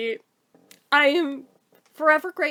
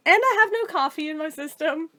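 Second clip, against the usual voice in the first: ordinary delivery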